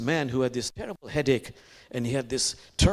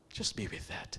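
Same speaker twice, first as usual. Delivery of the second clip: whispered invitation to reflect; 3 semitones lower, 12 dB quieter than usual. Real, not acted. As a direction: let it settle in a whisper. Quiet reflective invitation to congregation.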